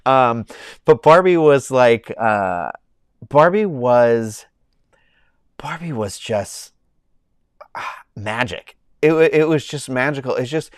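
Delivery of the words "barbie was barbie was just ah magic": awed whisper. Real, not acted. Awed pause over Barbie.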